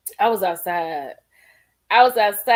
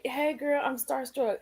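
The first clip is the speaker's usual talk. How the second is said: ordinary talk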